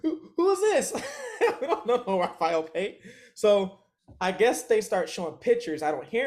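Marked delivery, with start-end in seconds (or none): 0.0-0.9 high, loud, laughing through the question; laughing mock confusion. Friends stalling the cop, laughing mock confusion. 1.6-3.0 laughing denial. Retold or imitated. Friends denying to the cops.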